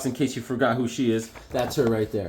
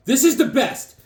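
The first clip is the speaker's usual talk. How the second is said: excited repeat; 10 semitones higher, 4 dB louder than usual. Real, not acted. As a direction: repeat it higher, full of delight.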